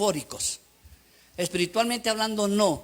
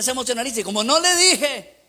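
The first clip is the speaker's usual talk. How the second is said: normal talking voice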